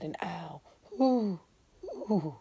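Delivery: usual speaking voice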